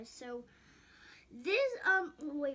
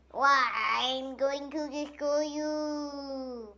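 villain voice; 4 semitones higher, 8 dB louder, slower than usual. Pretend. Toy villain voice, slow.

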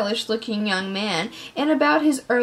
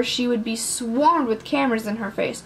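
usual speaking voice

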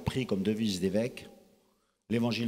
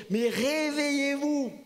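rousing call; 13 semitones higher, slower than usual. Acted. Preacher calls the church to wake.

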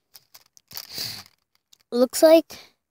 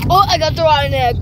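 fast, higher disgusted cry; disgusted exclaim; 4 semitones higher, 4 dB louder, faster than usual. Real, not acted. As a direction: blurt it out in disgust. Reacting to rotten egg bean.

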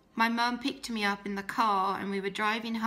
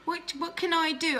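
high, rising anxious voice; high, frightened thoughts after the threat to tell dad; scared panic; 9 semitones higher than usual. Retold or imitated. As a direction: pitch it up high, frightened and lost. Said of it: "I was like".

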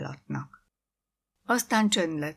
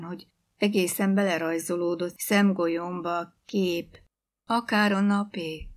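plain reading voice

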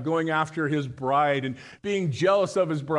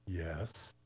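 much lower and much softer, bracing for the insult; wary quiet yes; 9 semitones lower, 15 dB quieter than usual. Acted. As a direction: say it low and soft, bracing yourself. Woman answers the parrot.

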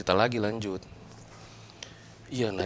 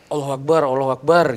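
mock cry for God, raised and louder; retold yelling; 3 semitones higher, 5 dB louder than usual. Telling how they fought off possession, imitated yelling. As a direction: call it out as a re-enacted scream. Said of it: "Aku teriak-teriak".

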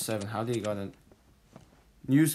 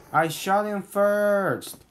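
raging gamer; 7 semitones higher, 6 dB louder, slower than usual. Real, not acted. Frustrated gamer insisting.

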